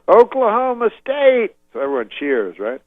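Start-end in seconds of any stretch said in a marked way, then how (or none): 0.1-1.5 high, loud, drawn-out stadium call; crowd-rousing yell. Retold or imitated. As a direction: whip up the crowd. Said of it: "He goes".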